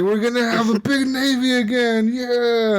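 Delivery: high-pitched mock British rallying cry; mock British cheer. Gamer mocking British players who love ships, high mock cheer. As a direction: ham up a proud Briton shouting yeah. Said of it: "They're like".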